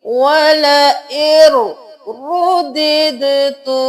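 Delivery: वला एर रुद्दितु drawn out in tilawat style; slow recitation. Shown as a demonstration. Teacher reciting the corrected phrase slowly. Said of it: "उनको नहीं पढ़ेंगे".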